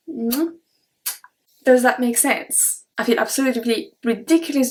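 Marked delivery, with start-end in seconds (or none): 0.1-0.6 soft air kiss by the cheek; kiss noise. Shown as a demonstration. Teacher shows the cheek kiss, a soft smack. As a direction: make the little kiss sound. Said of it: "then we add the noise".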